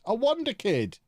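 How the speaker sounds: light up at the find; excited. Real, not acted. Excited at spotting talent.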